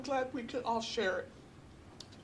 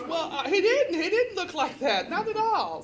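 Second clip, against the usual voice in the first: laughing quote; 8 semitones higher, 9 dB louder than usual. Retold or imitated. Quoting friend's joking reply.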